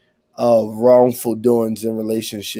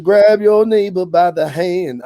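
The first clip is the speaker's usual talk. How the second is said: higher preacher call, pushed harder; hollering revival preacher; 7 semitones higher, 4 dB louder than usual. Pretend. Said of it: "I said".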